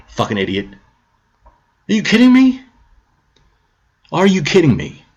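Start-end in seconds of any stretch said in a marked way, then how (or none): none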